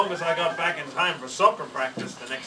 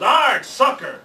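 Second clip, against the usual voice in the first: loud, higher pirate yell; 8 semitones higher, 8 dB louder, slower than usual. Acted. Pirate captain plays soccer, loud yell. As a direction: yell it kicking the ball. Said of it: "kicked the ball and yelled".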